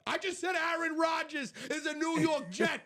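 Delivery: high-pitched exasperated holler; incredulous shouting. Real, not acted. Co-host insists his buddy missed the news, incredulous shout. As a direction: holler the news like nobody heard it.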